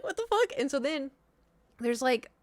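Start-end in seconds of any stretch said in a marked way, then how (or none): 0.0-0.5 pitched-up what-the-fuck of disbelief; baffled outburst. Retold or imitated. To the nurse's baffling question, a high incredulous blurt. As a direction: blurt it in total confusion.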